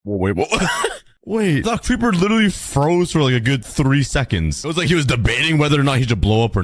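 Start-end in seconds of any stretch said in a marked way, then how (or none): 0.5-1.0 quick high giggle; squeaky laugh. Real, not acted. Player laughing at a frozen creeper.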